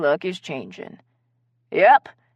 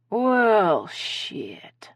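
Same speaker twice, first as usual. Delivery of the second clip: resigned sigh, trailing to a breath; 2 semitones higher than usual. Acted. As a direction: sigh it out, defeated. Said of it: "Carrie said with a sigh".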